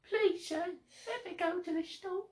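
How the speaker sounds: very high, soft begging; put-on pleading voice. Mock-begging a sir to visit the store, tiny pleading voice. Playing a pleading character for example.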